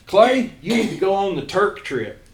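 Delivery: high, loud South Central Kentucky twang; South Central Kentucky accent. Retold or imitated. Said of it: "this deep South Central Kentucky accent".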